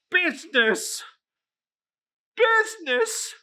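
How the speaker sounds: high, loud, rising echo; wailing ghost cry; anguished ghost. Acted. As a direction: fling the word back in torment.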